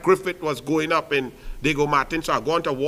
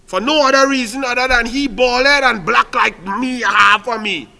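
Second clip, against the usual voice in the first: loud, higher, forceful declaration; fiery rant; 6 semitones higher, 8 dB louder than usual. Real, not acted. Speaker telling voters he is African too, shouting. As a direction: hammer home your identity.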